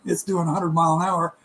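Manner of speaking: usual speaking voice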